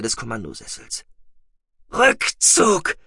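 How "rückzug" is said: croaked out, raised and strained; rasping croak. A narrator's reading. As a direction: rasp out the word, choking. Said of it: "röchelte sie".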